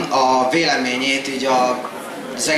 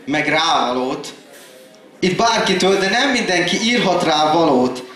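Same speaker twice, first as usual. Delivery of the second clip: rhythmic poem delivery, higher than casual talk; slam poetry recital; 4 semitones higher than usual. Acted. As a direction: recite in measured phrases, dropping at stanza ends.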